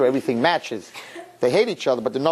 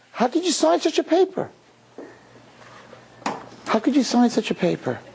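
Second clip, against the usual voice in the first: high-pitched mock dismay; high, pleading disbelief; reproachful girlfriend; 11 semitones higher than usual. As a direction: imitate her upset protest. Upset girlfriend reproaches him.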